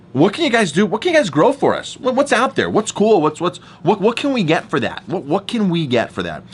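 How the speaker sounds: a buyer hyping up the farmers, high and eager; eager pitch. Retold or imitated. Brewer pitching to a table of farmers, high and eager.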